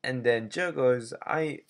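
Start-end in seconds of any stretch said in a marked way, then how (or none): 1.3-1.6 bad Jamaican impression. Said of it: "a very bad impression of a Jamaican man".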